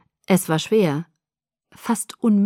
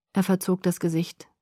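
plain reading voice

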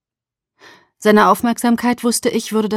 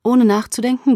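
usual speaking voice

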